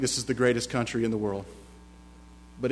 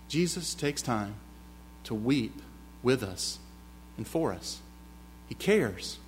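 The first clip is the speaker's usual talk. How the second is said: much softer, slow and gentle; hushed tender preaching; 11 dB quieter, slower than usual. Acted. Tender pause after Jesus wept.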